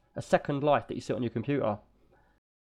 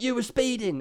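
mock cop; 10 semitones higher than usual. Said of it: "And he's like".

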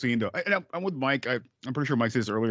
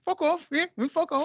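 very high put-on dismissive voice; imitating the gangster. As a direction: shoo them off in a silly squeaky voice. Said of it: "Like, like".

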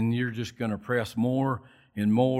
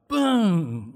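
slow, drawn-out mock boom; imitated boom; 6 semitones higher, 5 dB louder than usual. Dropping the heavy weights, a slow mock boom. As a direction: let the weight crash down slowly. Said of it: "he's like".

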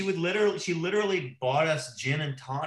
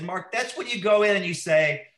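higher, louder scolding boss voice; mock the mad boss telling him off; retold angry boss; 8 semitones higher, 6 dB louder than usual. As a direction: boss scolding her young caller, imitated with a raised, louder voice. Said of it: "she was so mad".

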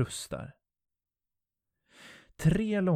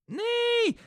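screaming. Acted. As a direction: scream as he is dragged away. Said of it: "skriker hon". (Jennifer skriker förtvivlat)

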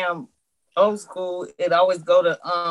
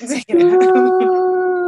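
drawn-out laughing, louder and high; laughing plea; 13 semitones higher, 8 dB louder, much slower than usual. Real, not acted. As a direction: beg through the laughter, stretching it out. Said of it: "Please take it out".

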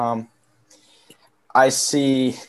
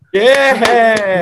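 loud, high, whooping cheer; hyped cheer; 10 semitones higher, 9 dB louder than usual. Real, not acted. Co-host cheers on Big Dog Barks, hyped whoop. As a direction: whoop it up for the segment.

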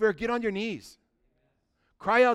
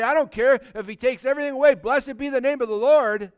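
louder, defiant praise; bold declaration; 5 semitones higher, 7 dB louder than usual. Pretend. A believer in hardship praises before onlookers, loud and bold. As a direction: proclaim it for everyone to hear.

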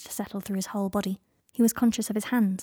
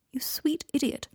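tender whisper; 5 semitones higher than usual. A narrator's reading. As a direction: breathe it tenderly.

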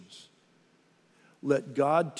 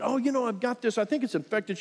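offhand patient; 8 semitones higher than usual. Retold or imitated. Retold chat with the doctor.

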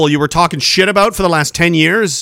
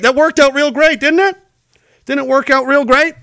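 sarcastic; 5 semitones higher than usual. Real, not acted. Sarcastic rhetorical taunt.

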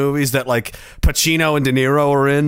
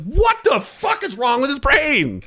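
baffled; 11 semitones higher than usual. Retold or imitated. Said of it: "I was like".

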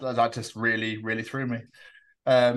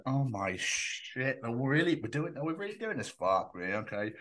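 muttered aside; 7 dB quieter than usual. Retold or imitated. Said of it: "he was there going".

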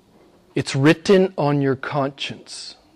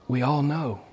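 softer, settling the point; soft repeat; 8 dB quieter than usual. Real, not acted. Preacher to congregation, quiet repeat. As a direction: let it land gently.

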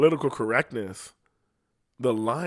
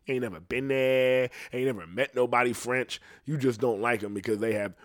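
ordinary talk

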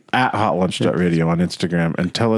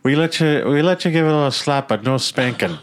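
put-on Irish accent; 6 semitones higher than usual. Irish club host bit continues.

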